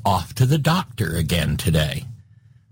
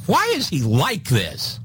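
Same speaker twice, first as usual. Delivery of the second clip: exasperated question; 6 semitones higher than usual. Retold or imitated. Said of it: "one person asking".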